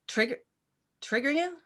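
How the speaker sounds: baffled echo, climbing up high; incredulous. Retold or imitated. Scene: teacher echoing students' complaint, climbing high in disbelief. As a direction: pitch rising high in disbelief.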